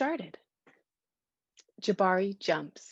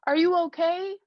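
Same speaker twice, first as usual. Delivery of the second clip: dad calls up the ladder, a bit louder; calling up to son; 9 semitones higher, 4 dB louder than usual. A narrator's reading. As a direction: call up to him. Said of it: "called his dad".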